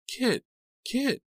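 very high frantic cry of a name; panicked cry. Acted. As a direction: shriek the name in terror. Panicked calling out names.

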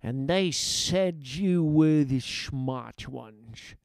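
slow, scornful villain voice; contemptuous captain. A narrator's reading. Guard captain berates captive workers, contemptuous. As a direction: drag it out with contempt. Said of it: "he said, spitting on the floor".

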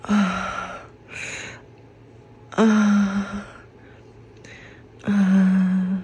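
loud high-pitched moans; moaning. Shown as a demonstration. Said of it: "But here we go".

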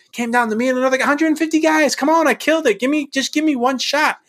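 very high, loud exasperated plea; worked-up retelling of a frustrated protest; frustrated venting. Retold or imitated. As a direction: rant and plead with the voice way up. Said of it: "I'm so frustrated about that".

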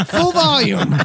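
high, amused, laughed-out words; laughing. Real, not acted. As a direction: say it through real laughter. Laughing over the chanting story.

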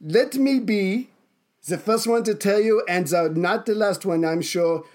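slow, deliberate mock-German dressing-down; comic dictator with German accent; acted Hitler. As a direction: draw out the rebuke in character. Plays Hitler scolding the officer.

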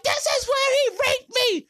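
high, loud mock crying accusation; hammed-up hysterical wail, very high-pitched; imitated wailing. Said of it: "wailing like a banshee crying".

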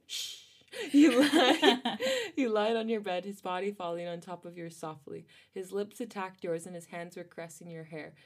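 normal talking voice

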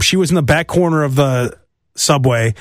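normal talking voice

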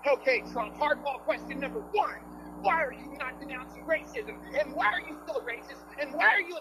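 high, mocking grilling voice; hostile reporter. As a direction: fire off gotcha questions in a high mock voice.

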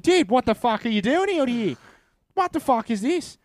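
high, loud outraged shout; shocked angry son. Retold or imitated. Son confronting his hiding father, outraged shout. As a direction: demand answers from a runaway dad. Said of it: "Robert, he's like".

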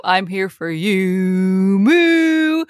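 lightly voiced cow with a mooing end; playful cow voice; mock cow. Voicing a cow.

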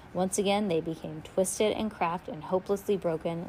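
usual speaking voice

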